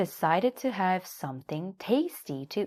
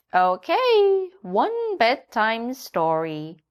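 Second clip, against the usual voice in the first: high, louder hen voice; a bright, clucking hen; Nanny Hen; 6 semitones higher, 6 dB louder, slower than usual. A narrator's reading. Hen greets the little donkey in a high voice. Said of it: "said Nanny Hen".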